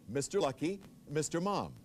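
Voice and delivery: usual speaking voice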